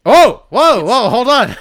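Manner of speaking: loud, high startled whoa; mock surprise. Comic startled greeting of a roast visitor. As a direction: act thrown by someone walking in.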